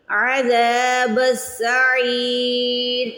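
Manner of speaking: عَذَابُ السَّعِيرِ with a throaty ain and long ذا; stretched ain and dhal. Shown as a demonstration. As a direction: recite عَذَابُ السَّعِيرِ slowly, drawing out ذا and عی. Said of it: "عی کو کھینچیں گے". (عذاب میں عین کی آواز اور زال کھینچ کر)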